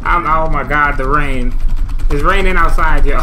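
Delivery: loud, outraged ranting; furious yelling. Real, not acted. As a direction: rant at full volume. Furious at the game.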